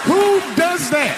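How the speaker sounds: high, drawn-out cry of disbelief; stretched, high-pitched indignation; outraged exclamation. Acted. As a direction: exclaim in comic outrage, slowly. Outraged at wife opening the box.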